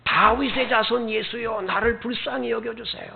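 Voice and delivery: high-pitched pleading call, held back in volume; mock crying out. Blind beggar calling to Jesus for mercy, mock cry. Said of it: "소리를 지르기 시작합니다".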